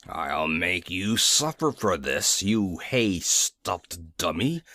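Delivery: low, slow, menacing growl of a king; wicked king glaring. A narrator's reading. Nome King glares at the Scarecrow, low slow menace. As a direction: threaten him slowly from low in the throat. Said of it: "to glare wickedly upon the Scarecrow and exclaim".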